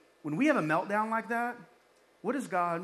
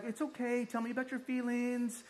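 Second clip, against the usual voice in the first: high, soothing mock coddle; exaggerated tender concern, high-pitched; coddling; 7 semitones higher than usual. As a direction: coddle them like an upset child, pitched up. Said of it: "coddles them".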